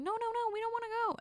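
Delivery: put-on very high whiny-child refusal; protesting kids. Children refusing dad's poop factory trip, high protesting. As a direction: plead out of it in a high kid voice.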